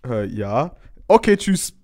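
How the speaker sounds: loud, high phone caller voice; acted phone caller. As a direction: play both sides of a call. Acted phone call to police.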